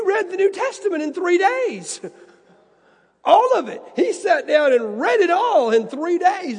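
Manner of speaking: high and loud, marveling; can't believe he read it all; amazed. Real, not acted. Preacher tells the congregation Isaac read it all, loud and amazed.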